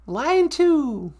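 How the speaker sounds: high, singsong call-out; high-voiced sidekick announcing. Sidekick announcing a caller to host, high voice. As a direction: sing it out up high.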